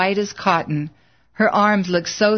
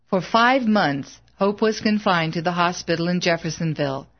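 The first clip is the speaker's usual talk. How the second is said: usual speaking voice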